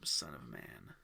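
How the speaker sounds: deep sneering devil voice; low villain. Acted. Lucifer greets the man at the cave, deep and sly. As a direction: drop deep on son of man like a serpent.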